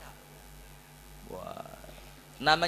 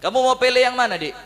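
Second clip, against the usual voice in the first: higher, louder bright offer; cheerful coaxing; 5 semitones higher, 6 dB louder than usual. Acted. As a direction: win over the wife with gold. Husband coaxing angry wife. (membujuk istri dengan riang)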